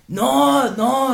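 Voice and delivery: high, pleading mock protest; deaf man imitation. Deaf man showing hearing aids, high mock protest. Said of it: "he was actually deaf".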